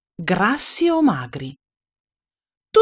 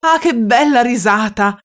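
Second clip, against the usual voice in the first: exclamation; 3 semitones higher, 4 dB louder than usual. Acted. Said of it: "esclamò un vecchio del branco".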